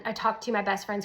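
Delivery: ordinary talk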